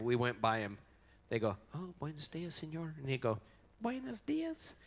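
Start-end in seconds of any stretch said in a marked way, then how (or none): 3.8-4.7 falsetto, far above normal speech; light, comic falsetto hello; odd high greeting voice. Retold or imitated. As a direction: imitate a friend's helplessly high greeting. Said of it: "Why do I do that with my voice".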